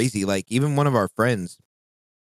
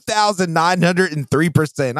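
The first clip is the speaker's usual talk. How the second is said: mock announcement; 5 semitones higher, 4 dB louder than usual. Reading out a stats notification.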